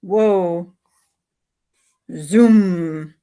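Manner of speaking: ordinary delivery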